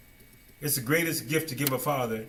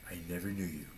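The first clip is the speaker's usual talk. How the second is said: much softer, lower final verdict; cold quiet rejection; 7 semitones lower, 13 dB quieter, faster than usual. Acted. The Father rejecting the faithless, soft and cold. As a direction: drop to a chilling hush.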